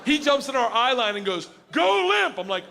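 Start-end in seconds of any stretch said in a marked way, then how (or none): 1.7-2.4 high, loud urgent shout; frantic trainer shout; panicked command. Retold or imitated. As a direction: yell across to save him.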